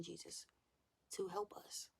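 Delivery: whispered plea to Jesus. Real, not acted. Vlogger asking Jesus for help, whispered. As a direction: pray it under your breath. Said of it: "whispered prayer".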